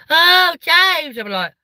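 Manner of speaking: high, loud gushing "Oh, James"; mock adoring fan. Mocking the fans who greet James Acaster, gushing high and loud. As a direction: gush over him like a starstruck fan.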